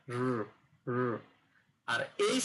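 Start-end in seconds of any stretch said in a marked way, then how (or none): 0.1-1.0 "র" as a held English r; English r demo. Shown as a demonstration. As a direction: hold "র" on its own without tapping it. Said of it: "তারপর উচ্চারণ হবে". ("র" ইংরেজি ধ্বনির মতো টেনে বলা)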